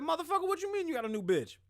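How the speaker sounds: high, fast mock of a grown son cursing out his dad; retelling his son's outburst in a high, quick voice; imitating his son. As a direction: squeak it out fast like an outraged kid. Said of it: "He said".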